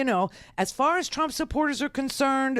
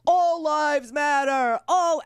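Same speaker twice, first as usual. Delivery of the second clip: high, slow, drawn-out mob yell; mock yelling; 5 semitones higher, 3 dB louder, slower than usual. Host mimicking a conservative flash mob, mock screaming and yelling. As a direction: imitate a yelling mob, pitched up and stretched. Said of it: "screaming and yelling".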